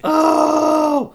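high, loud burst of shock; sharp startled cry; shocked gasp. Real, not acted. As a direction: blurt it out in disbelief. Cohost reacts to shocking story.

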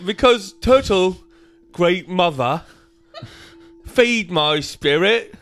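slow, high, loud British chimney sweep; terrible British accent. Acted. Reading a little poem as Lewis, in a slow, terrible British accent. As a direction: declaim it in a hammed-up British accent, near laughing. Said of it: "can I read ... like, as Lewis".

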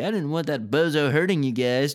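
plain reading voice

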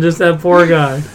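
ordinary delivery

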